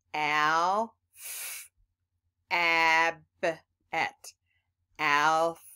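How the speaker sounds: "Ab." said with a full short a; short a syllables. Shown as a demonstration. Teacher sounding out each syllable for kids. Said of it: "Did you hear how this a sounded".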